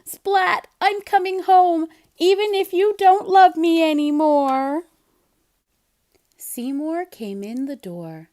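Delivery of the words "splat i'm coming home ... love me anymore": high, loud hurt outburst; upset cry. Acted. Seymour crying out to Splat about going home, loud. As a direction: call out, hurt and fed up.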